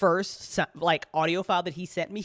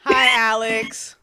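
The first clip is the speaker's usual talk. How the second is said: excited greeting; 4 semitones higher, 8 dB louder, slower than usual. Real, not acted. Greeting a viewer in chat.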